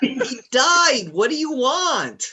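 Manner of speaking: high, loud, incredulous yell; exasperated shout. Real, not acted. Game master answering a complaining player after a death, exasperated shout.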